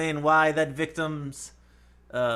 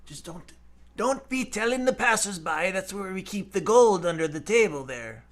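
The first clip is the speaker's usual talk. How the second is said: leprechaun brogue, confiding; Irish accent; 4 dB quieter than usual. Acted. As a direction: warn them in a put-on Irish accent. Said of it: "making me do the accent".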